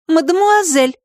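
мадемуазель said high with a firm falling correction; a girl primly correcting the cat. Acted. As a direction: set him straight on мадемуазель. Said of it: "поправила его Катя".

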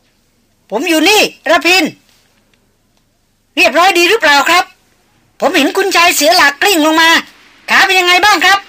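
loud, high, steady shout back; firm call. A narrator's reading. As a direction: answer firmly over the wind. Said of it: "เสียงร้องตอบมาอย่างหนักแน่นมั่นคงว่า".

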